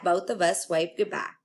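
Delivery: ordinary delivery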